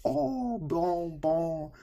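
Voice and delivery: high, drawn-out mock French approval; impressed French professors. Other professors hearing the student's French, imitated impressed cooing.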